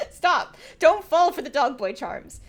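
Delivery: loud, high playful yell; mock scolding. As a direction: shout a warning in mock alarm. Mock scolding at flirty character.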